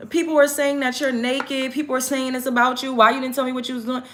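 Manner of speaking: high, fast mock mother scolding; mother grilling her daughter about the video, mock scolding. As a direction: fire off the questions like a worried mom.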